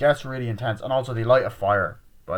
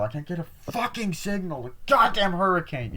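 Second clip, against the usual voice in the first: frustrated rant; 9 semitones higher than usual. Retold or imitated. Retelling no signal in the hurricane, frustrated rant. Acted failed call in hurricane.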